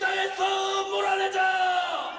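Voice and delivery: shouted rally cry; roaring rallying speech. Real, not acted. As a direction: rouse the crowd at full voice. (포효하는 집회 연설)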